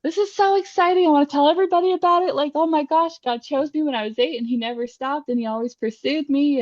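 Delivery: high, fast, bubbling over with joy; gushing excitement. Retold or imitated. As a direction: burst out like you can't wait to tell everyone. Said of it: "that charismatic comes out in you where you're just like".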